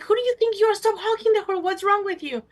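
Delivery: high, loud scolding; angry retort. Retold or imitated. Telling the boyfriend to stop honking, angry. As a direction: snap back at him hard. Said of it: "He's like".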